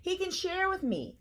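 high-pitched eager offer; crying out. A narrator's reading. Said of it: "cried Hedgehog".

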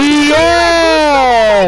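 loud high cheer; excited yell. Real, not acted. Teammates hype up in fight.

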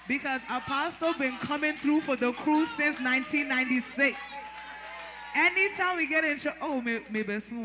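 loud, high shout of praise. Real, not acted. Praising Apostle before the church, a loud high shout. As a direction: shout it out to the congregation.

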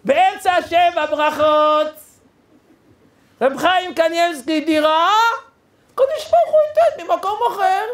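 high, amazed proclamation, building; imitating an awestruck groom; groom's imitated shouting. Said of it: "איזה שאגה הוא צעק".

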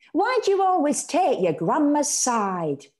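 put-on a kid lashing out at mum; hurt child. A narrator's reading. Child accusing her mother.

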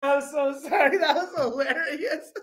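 high-pitched words through laughter; laughing while talking. Real, not acted. Streamer apologizing to chat while laughing, high-pitched. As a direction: talk while you can barely stop laughing. Still laughing after the clip.

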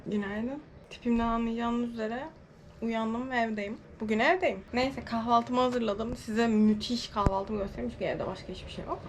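soft, just-woke-up murmur; soft morning vlog voice just out of bed; sleepy morning voice. Real, not acted.

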